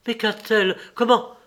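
high, urgent questioning; alarmed exclamation. A narrator's reading. Lévin hears of her illness, cries out in alarm. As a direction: press for an answer, pitch jumping up. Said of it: "cria Lévin".

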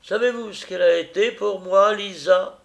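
loud, high, swept away with feeling; carried-away cry. A narrator's reading. The drunken father cries out about Lisa, loud and carried away. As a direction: cry out, beside himself. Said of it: "il criait comme transporté".

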